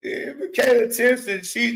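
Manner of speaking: usual speaking voice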